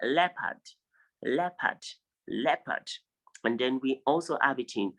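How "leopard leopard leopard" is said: leopard as LEP-ad, higher pitched; correct pronunciation drill. Shown as a demonstration. Teacher drilling students to repeat, leopard as LEP-ad.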